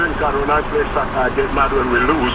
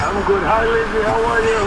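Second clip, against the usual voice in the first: pitched-up lady voice greeting; put-on voice; 8 semitones higher than usual. Caller greeting the host in a higher put-on voice. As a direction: play the nice lady who called Tony. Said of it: "Oh, you're good at voices".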